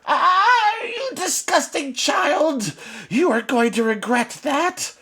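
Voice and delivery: very high, slow enraged screech; bitten witch howl. Acted. Witch Sabine bitten by Holly, furious. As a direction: howl with pain and rage.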